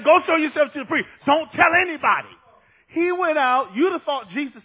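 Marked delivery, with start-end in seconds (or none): 0.0-2.3 loud, raised preacher's quote; emphatic command. Retold or imitated. Jesus ordering the healed leper, emphatic command. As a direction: drive each instruction home loudly.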